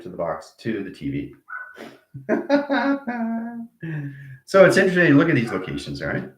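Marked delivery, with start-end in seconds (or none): 2.1-4.4 high musical laugh; sing-song laugh. Real, not acted. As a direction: laugh as if singing it out. Sung laugh between points.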